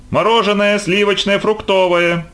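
high, sustained sing-out; high-pitched ice-cream seller's call; street vendor's cry. Acted. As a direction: call out your wares along the street. Street vendor calling out wares.

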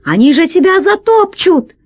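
high-pitched cry of warning; alarmed shout. A narrator's reading. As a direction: warn a crewmate in alarm. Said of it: "закричал из люка крыс". (тревожный высокий крик)

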